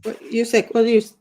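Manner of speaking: ordinary talk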